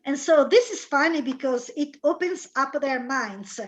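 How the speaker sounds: usual speaking voice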